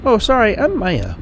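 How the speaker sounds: high, lilting put-on female accent; Maya. Traveling artist introducing herself, amateur female accent. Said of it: "I'm trying to do an accent".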